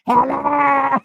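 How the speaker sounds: mock shouted phone greeting; imitating Miss Linda's hello. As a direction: bark hello like a raspy smoker. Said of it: "that lady said".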